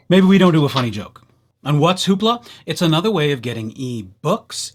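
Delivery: normal talking voice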